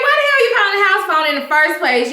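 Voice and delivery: high, fast indignant outburst; indignant retort. Retold or imitated. Caller questioned about phoning the house, indignant retort. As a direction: fire it off high and quick.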